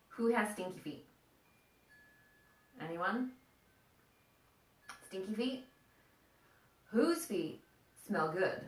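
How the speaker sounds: ordinary talk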